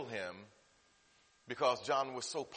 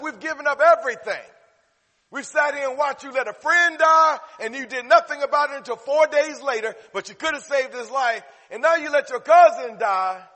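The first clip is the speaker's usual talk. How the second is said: loud, high, acted accusation; preacher voicing outraged followers at full force; indignant reproach; 13 semitones higher, 16 dB louder than usual. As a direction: confront a leader who let you down. Preacher voices disciples reproaching Jesus.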